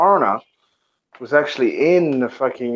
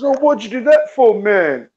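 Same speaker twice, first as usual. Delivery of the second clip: high, louder aggrieved complaint; retold indignant protest; 8 semitones higher, 4 dB louder than usual. Puncher getting up off the floor, retold indignant protest. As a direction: protest in a raised, higher pitch.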